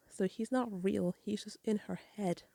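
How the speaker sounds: normal talking voice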